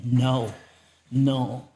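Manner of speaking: firm, mildly shouted refusal; retold scream. Healer fighting a demon, retold mock yell. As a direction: imitate a healer's yell, held in check. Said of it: "he's screaming".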